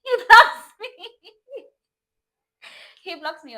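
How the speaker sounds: very high, loud shriek breaking into laughter. Real, not acted. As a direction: scream it in disbelief, then laugh through it. Shrieks the repeat while laughing.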